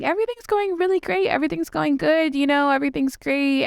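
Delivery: high, chirpy fake-upbeat imitation, easing down; mock cheerful. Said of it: "they'll start off by saying".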